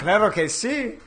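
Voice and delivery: put-on laughing Mexican accent, pitched high. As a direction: throw it off in a Mexican accent, chuckling. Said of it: "How did I do, Steve?".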